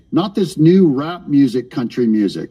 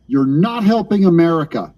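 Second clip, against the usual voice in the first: ordinary talk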